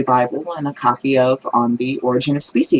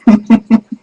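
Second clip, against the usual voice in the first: laugh; 5 semitones higher, 5 dB louder than usual. Real, not acted. Teller laughs at own punchline.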